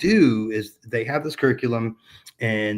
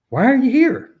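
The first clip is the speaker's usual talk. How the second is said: much higher and louder, a put-on voice for Bob; imitating Bob's question; 14 semitones higher, 8 dB louder than usual. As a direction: play the mentor's blunt question in a changed voice. Bob asks him bluntly.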